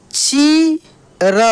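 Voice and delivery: long high ची, pause, then र; drawn-out syllables. Shown as a demonstration. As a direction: hold ची high before sounding र. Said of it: "खींच कर किया जाता है". (ची खींच कर, फिर र)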